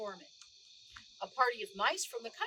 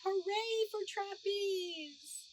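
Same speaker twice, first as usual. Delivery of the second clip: cheering crowd; 9 semitones higher, much slower than usual. A narrator's reading. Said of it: "they shouted".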